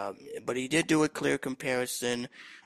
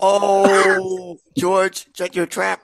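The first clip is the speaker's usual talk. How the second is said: louder, slow and stern; loud scolding; 8 semitones higher, 13 dB louder, much slower than usual. Real, not acted. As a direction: tell him off loudly, slowly. Scolding the talking pet.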